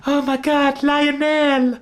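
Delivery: high, airy falsetto cry; hammed-up star in mock shock. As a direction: exclaim it in put-on falsetto. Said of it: "[name] just goes".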